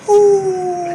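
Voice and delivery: high, loud husky-dog whoo; husky howl. Retold or imitated. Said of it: "She'll say".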